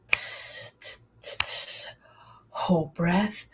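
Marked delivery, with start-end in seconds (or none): 0.1-1.8 soft tss hi-hat sound, unvoiced; cymbal hiss. Shown as a demonstration. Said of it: "My turn".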